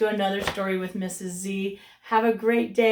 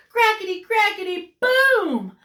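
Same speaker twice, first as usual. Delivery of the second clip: building boom; 9 semitones higher, 7 dB louder than usual. A narrator's reading. Story sound effect build.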